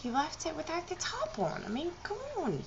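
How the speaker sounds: put-on high-pitched British-accented griping; hammed-up indignant fussing; mock exasperation. Mock complaint about husband's toothpaste.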